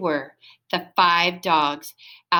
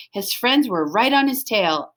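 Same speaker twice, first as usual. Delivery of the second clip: ordinary delivery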